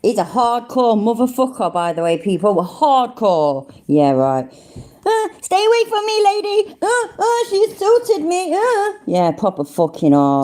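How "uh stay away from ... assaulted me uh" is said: high, whimpering scared wimp; mock him as a frightened weakling; whiny coward voice. Mocking the "hardcore" guy as a coward.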